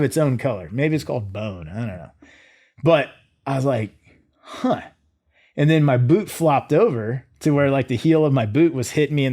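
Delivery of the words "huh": a high curious huh; mild wonder at the sight; puzzled huh. Retold or imitated. Soldier puzzling over his own leg bone, curious huh.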